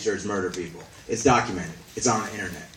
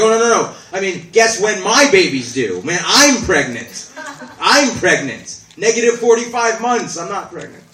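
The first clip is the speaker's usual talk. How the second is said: higher and louder, scrambling; flustered backpedal; 8 semitones higher, 7 dB louder than usual. Retold or imitated. Woman covering her blunder, flustered and loud.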